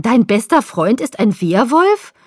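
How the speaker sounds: loud, drawn-out incredulous question; stunned, stretched-out question; appalled disbelief. A narrator's reading. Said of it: "Sie sah mich entsetzt an".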